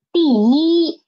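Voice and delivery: 第 falling, 一 held high and level; first-tone yi. Shown as a demonstration. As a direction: demonstrate 第一 with an unchanged first tone on 一. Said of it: "So E won't change".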